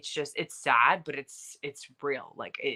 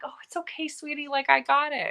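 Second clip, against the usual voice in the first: very high, sweet pushover voice; sugary girly voice; 13 semitones higher, 3 dB louder than usual. Retold or imitated. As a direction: chirp it like a too-nice girlfriend. Mocking the overly accommodating American girl.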